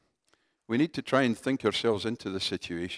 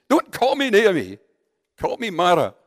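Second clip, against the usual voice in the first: much higher and much louder furious cry; explosive outburst; 8 semitones higher, 11 dB louder than usual. Retold or imitated. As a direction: explode at the villagers, refusing her name. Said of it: "she explodes and says".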